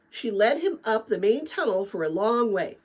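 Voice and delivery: usual speaking voice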